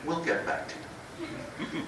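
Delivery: slow, soft, breathy brush-off; dismissive brush-off. Retold or imitated. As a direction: let them down coldly and slowly. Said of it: "In that tone of voice".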